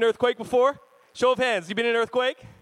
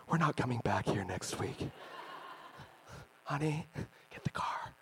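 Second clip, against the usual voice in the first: low, hushed churchgoer's aside; mock whisper; 9 semitones lower, 10 dB quieter than usual. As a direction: whisper it furtively to your spouse, in jest. Offended churchgoer mutters to spouse.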